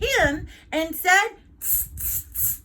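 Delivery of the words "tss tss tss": whispered tss tss tss; monkey tss. Acted. Monkeys mocking the peddler from the tree, whispered tss. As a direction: tease him back with whispered tsks.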